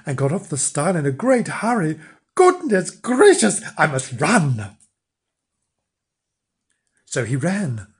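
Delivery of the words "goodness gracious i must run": flustered little man. Acted. Flange hurries off.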